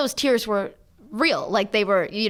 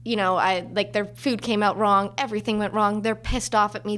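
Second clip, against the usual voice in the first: normal talking voice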